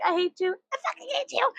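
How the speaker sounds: high, squeaky mock outburst; mock hatred. American talking back to death, high mock hatred. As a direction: spit out playful hate in a very high voice.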